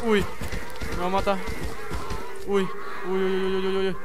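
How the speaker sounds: loud, high, frantic pleading; real panic. Real, not acted. Gamer fleeing a monster chasing him, loud real panic. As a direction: beg and flee in genuine fright. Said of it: "qué remiedo que tengo".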